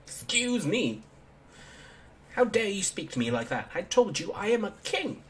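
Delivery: put-on high-pitched indignant child; offended kid king. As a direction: demand respect like a tiny monarch.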